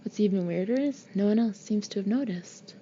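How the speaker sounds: ordinary delivery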